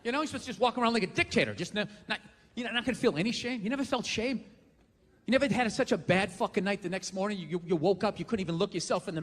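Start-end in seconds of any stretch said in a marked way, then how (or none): none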